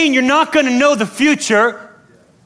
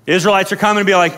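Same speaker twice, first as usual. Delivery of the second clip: ordinary talk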